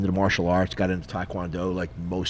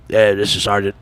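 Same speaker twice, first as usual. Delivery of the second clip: gruff army sergeant; 2 semitones higher, 9 dB louder than usual. Retold or imitated. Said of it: "And he's got that voice".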